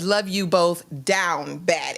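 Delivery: normal talking voice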